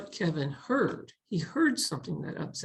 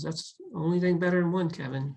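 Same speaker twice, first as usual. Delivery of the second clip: usual speaking voice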